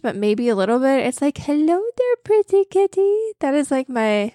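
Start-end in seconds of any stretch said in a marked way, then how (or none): 1.4-3.2 put-on very high, cooing baby talk; cat voice. Shown as a demonstration. Host demos her go-to cat voice, high cooing. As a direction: coo sweetly up high at a kitten. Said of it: "my go-to cat voice".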